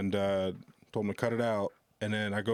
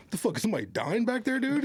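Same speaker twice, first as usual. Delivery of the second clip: higher, fast, annoyed heckle; irritated comic; 11 semitones higher, faster than usual. Retold or imitated. Comic on stage demands what is happening, fast and high. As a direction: toss it off fast and high, fed up. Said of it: "And he's like".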